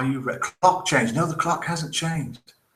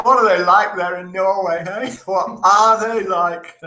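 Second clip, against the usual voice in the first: laughing; 3 semitones higher, 4 dB louder than usual. Real, not acted. Laughing after telling the joke.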